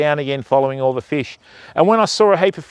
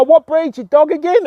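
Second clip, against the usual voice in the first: mock questioning mate; 11 semitones higher, 3 dB louder than usual. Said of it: "And he'd see me go".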